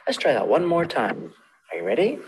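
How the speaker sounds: usual speaking voice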